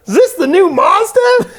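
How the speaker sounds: a high, goofy mechanic asking; Melvin. Retold or imitated. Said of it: "Melvin starts looking around".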